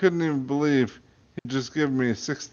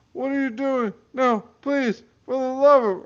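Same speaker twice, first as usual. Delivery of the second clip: terrified plea; 12 semitones higher, 4 dB louder than usual. Acted. Man pleads before death.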